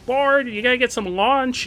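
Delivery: eager urging. Real, not acted.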